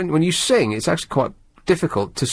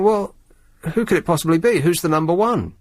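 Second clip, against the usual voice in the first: higher, wondering aloud; puzzled wondering; 6 semitones higher than usual. Retold or imitated. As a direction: puzzle over who it could be. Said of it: "and you're thinking".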